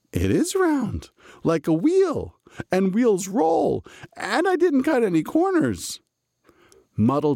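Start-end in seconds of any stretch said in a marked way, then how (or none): 0.1-6.0 beam as it dawns on him; delighted. A narrator's reading. Muddle pleased with his round rings, delighted. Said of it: "and he smiled".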